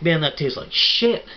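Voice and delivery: loud, slow, high-pitched revulsion; disgusted yell. Acted. Customer spits out the apple, loud slow disgusted yell. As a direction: spit the words out in hammed-up disgust.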